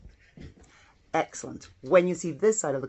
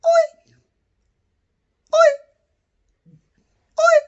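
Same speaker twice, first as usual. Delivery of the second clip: "Oi" said much higher and louder; 10 dB louder than usual. Shown as a demonstration. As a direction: squeak "Oi" up high for the class. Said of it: "Let's try a high voice".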